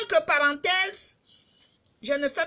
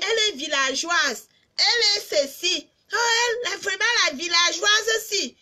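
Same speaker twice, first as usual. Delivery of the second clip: mimicked high, louder gossip; mock the name-callers in a raised, higher voice; imitating critics; 6 semitones higher, 4 dB louder than usual. Vlogger quoting those who call her a villager, mocking imitation. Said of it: "Arrêtez souvent de venir dire".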